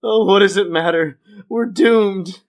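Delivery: hammed-up hopeless cry; despairing Homer. Homer gives up after being stopped drinking, mock despair.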